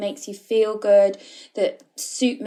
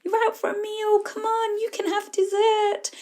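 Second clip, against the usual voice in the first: much higher, eager little voice; tempting child voice; 13 semitones higher than usual. Acted. The inner child tempting her at a restaurant, eager and much higher. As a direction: egg yourself on like an excited kid. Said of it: "I would have heard the child going".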